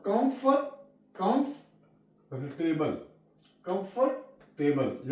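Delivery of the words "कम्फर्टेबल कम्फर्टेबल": कम्फर्टेबल slowly as kumf-ter-bul; silent o. Shown as a demonstration. Teacher slowly models कम्फर्टेबल for students. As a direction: drawl कम्फर्टेबल out, no o sound. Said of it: "यहाँ पे ओ silent है".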